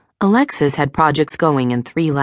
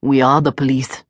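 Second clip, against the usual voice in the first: put-on Middle Eastern accent. A narrator's reading. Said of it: "She detected a Middle Eastern accent".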